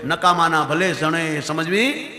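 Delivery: slow chanted couplet recitation; recited verse. Acted. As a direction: intone the verse slowly.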